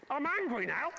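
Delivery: hammed-up angry host. Shown as a demonstration. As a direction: push the line up into a hammed-up rage.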